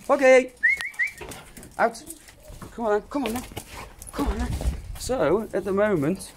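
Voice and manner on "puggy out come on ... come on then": high-pitched dog voice barking orders; bossy dog telling the pup off, then coaxing; voicing the dog Luna. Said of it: "don't be like".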